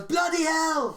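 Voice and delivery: high British Bloody hell; a hint of Hugh Grant swearing in British; British exclamation. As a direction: bark Bloody hell like a Brit. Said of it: "Hugh Grant calls me. He goes".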